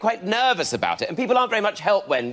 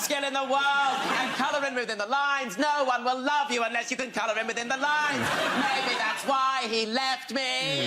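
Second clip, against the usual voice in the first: mock bitter teacher; 5 semitones higher, 5 dB quieter than usual. Mock teacher rant at kids.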